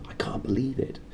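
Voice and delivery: breathless whisper of shock; stunned whisper. Acted. McGonagall hearing Lily and James are dead, shocked whisper. Shocked news of Potters' death.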